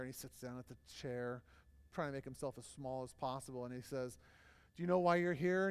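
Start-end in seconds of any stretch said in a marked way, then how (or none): 4.8-5.7 loud, stern boss question. Retold or imitated. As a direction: loom over him with the question. Boss confronts the employee.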